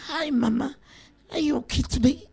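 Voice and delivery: softly mocked American accent greeting mama. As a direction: put on a light American accent, quietly. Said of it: "mama does not understand American accent".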